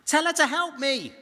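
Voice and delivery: high, loud, indignant pleading; exasperated sister crying out to the Lord; Martha's complaint. Acted. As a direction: play the overworked sister demanding help. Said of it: "She says".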